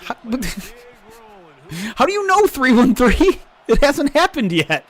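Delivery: high, loud, incredulous protest; amused incredulity. Real, not acted. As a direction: burst out in laughing disbelief. Incredulous at a reported score.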